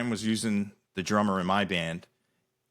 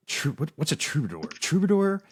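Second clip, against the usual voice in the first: higher-pitched retold bewilderment; baffled questioning; 6 semitones higher than usual. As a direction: puzzle over an unknown word, voice lifting at the end. Retells puzzling over a set list.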